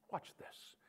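hushed watch this; whispered. Shown as a demonstration. Preacher whispering Elijah's line to the church, hushed. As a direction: breathe it out as a secret. Quiet emphatic aside.

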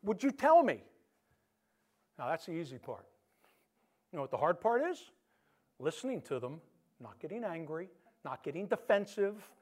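normal talking voice

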